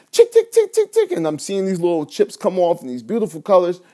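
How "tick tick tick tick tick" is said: loud, very high tick tick of chipping; high ticking pickaxe noise, louder. Retold or imitated. Dreamer making progress, imitating louder high ticks. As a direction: chip harder now, pitched way up and louder. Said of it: "it sounds like this".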